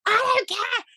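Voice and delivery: high, laughing mock snap; retold outburst. Patient losing it with an unhelpful doctor, high and half laughing. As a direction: replay losing your temper, half laughing. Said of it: "I literally lost it with this woman".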